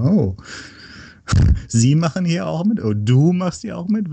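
high, delighted surprise; surprised. Retold or imitated. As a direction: retell a happy surprise, pitch up. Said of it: "wo ich dann das erste Mal gesehen habe".

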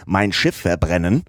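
plain reading voice

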